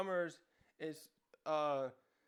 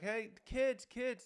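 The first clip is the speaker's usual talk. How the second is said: higher calling shout; yelling for kids; 7 semitones higher, much slower than usual. Retold or imitated. Rescuers calling out for the lost kids, high yelling. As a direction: call out across the distance to find them. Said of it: "They start yelling their names".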